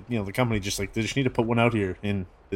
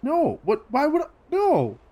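shocked protest. Acted. Vellum denies poisoning again.

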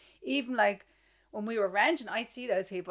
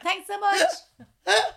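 higher, louder, slow and laughing; mock gushing thanks; 11 semitones higher, 10 dB louder, much slower than usual. Mocking co-host thanking a shelf stacker, high and laughing. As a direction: stretch the thanks out while laughing. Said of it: "you're like".

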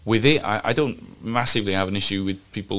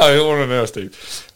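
laughing; 5 semitones higher, 7 dB louder than usual. Real, not acted.

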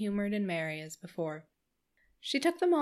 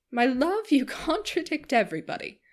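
higher, louder, laughing through the words; laughing reproach; 6 semitones higher, 5 dB louder than usual. Acted. Missus Palmer scolding her husband while laughing. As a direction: tease him with a giggle. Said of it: "with her usual laugh".

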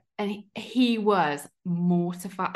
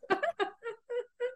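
helpless laugh; 14 semitones higher, 7 dB quieter than usual. Real, not acted. Reacting to the funny story.

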